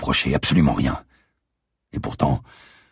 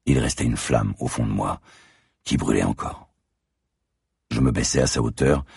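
usual speaking voice

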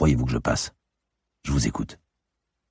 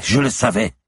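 high, louder cry of certainty; agitated outburst; 10 semitones higher, 5 dB louder than usual. Acted. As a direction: burst out, pitch leaping up. Repeated as an outburst. (éclat de voix aigu et agité)

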